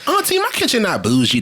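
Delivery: high, indignant niece; mock protest. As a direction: protest lightly to your aunt. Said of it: "She was like".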